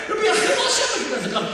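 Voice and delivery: high, loud, fast protest; indignant outburst. Retold or imitated. Assistant principal insisting to the wife, high and loud. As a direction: hammer the chilul Hashem accusation.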